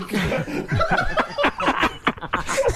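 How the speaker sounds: long high laugh; can't stop laughing at it. Real, not acted. Guest laughs telling about the dump hotel, high laugh.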